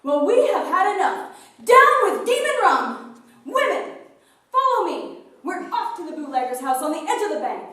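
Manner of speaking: very loud, high rallying cry; rallying shout. Acted. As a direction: rouse the women to march. Rallying the women.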